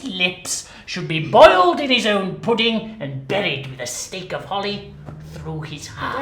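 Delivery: loud, raging miser; angry Scrooge tirade; furious rant. Acted. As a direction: spit out every threat. Scrooge rants.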